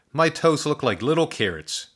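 put-on tickled boy; amused boy. A narrator's reading. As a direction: delight in the joke. Giggling boy.

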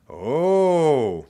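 long, high, loud "oh"; impressed wonder. A narrator's reading. Pupil watches paper fly, impressed and amazed. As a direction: swell with amazement, drawn out.

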